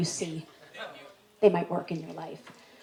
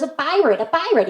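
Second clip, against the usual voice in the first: put-on insistent kid; 7 semitones higher, 4 dB louder than usual. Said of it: "little problem with speech".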